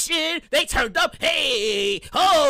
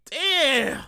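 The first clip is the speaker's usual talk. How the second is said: ordinary delivery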